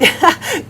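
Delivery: short high giggle breaking in; quick giggle. Real, not acted. Soaper describing vibrant colors, quick giggle. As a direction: let a giggle slip out.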